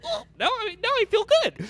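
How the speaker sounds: very high, squeaky feel-good voice; hammed-up high-pitched contentment; mock relief. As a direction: squeal it like an addict's instant relief.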